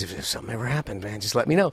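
low, soft, quick murmur; imitating a regular customer. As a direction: quote him in a low, confidential voice. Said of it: "he always goes, he goes".

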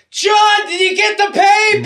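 very high, loud holler; imitated neighbor yelling. Neighbor calling to John about the paper, high imitated yell. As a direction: holler it down the street like a cranky neighbor. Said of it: "he's yelling like".

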